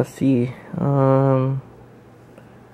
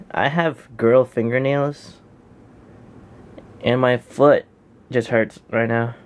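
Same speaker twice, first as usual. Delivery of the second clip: ordinary talk, nothing marked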